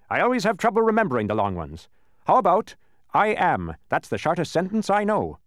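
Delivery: high, animated pronouncement; pompous judge. A narrator's reading. Judge choosing the shortest sentence, pompous delivery. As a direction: hand down the sentence grandly.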